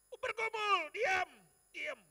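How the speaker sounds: very loud, high outburst; anguished shout. Retold or imitated. Preacher reliving his silent struggle, anguished high shout. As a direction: cry out the struggle at full force.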